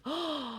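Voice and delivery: sharp, amazed intake of breath; astonished gasp. Acted. Last crow realizing his luck, astonished gasp. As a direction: gasp in dawning wonder.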